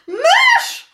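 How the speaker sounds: teacher screaming, leaping onto her stool; panicked scream. A narrator's reading. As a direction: scream in pure terror at the mouse. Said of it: "krzyknęła".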